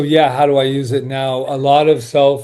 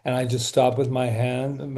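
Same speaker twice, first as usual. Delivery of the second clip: normal talking voice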